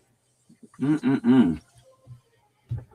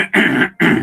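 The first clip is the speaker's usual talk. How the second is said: throat clearing; 11 semitones higher, 12 dB louder than usual. Real, not acted. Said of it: "my throat's been a bit annoying".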